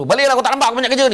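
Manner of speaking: fast, high irritated retort; annoyed. Acted. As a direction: brush them off, fed up. Pekerja menjawab dengan geram. (jawab dengan geram dan laju)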